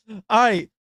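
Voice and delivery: ordinary delivery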